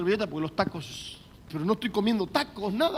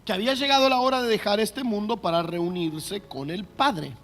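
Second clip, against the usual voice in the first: measured, raised Bible reading to the church; scripture reading; 3 dB louder than usual. Acted. Pastor reads John 13 aloud to the church, solemn reading. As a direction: read the verse out to the congregation.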